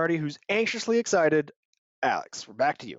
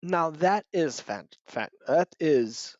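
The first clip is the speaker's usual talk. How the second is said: halting; slower than usual. Acted. Lady fumbles for words.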